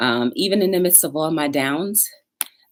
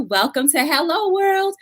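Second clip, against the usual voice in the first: ordinary talk, nothing marked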